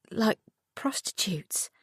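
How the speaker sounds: slow, stunned whisper; a mother aghast at the news, whispering; shocked whisper. A narrator's reading. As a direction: breathe the word out in disbelief. Lucy shocked, asking in disbelief.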